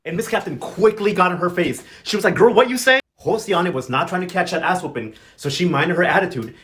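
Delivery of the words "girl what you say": high, loud mock confrontation; confrontational challenge. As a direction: square up to them, voice raised. Captain confronts a defiant prisoner.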